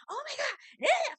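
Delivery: very high mock squeal of delight; mock gushing Lisa. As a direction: squeal it like an excited gossip. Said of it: "And here go Lisa".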